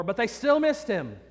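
usual speaking voice

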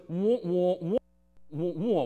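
muffled muted-trumpet wah wah; Peanuts teacher wah-wah. Retold or imitated. Preacher imitating the Peanuts teacher, muffled wah wah. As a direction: mimic the droning cartoon teacher. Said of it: "when the teacher goes".

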